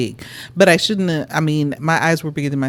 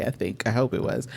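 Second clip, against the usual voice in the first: ordinary talk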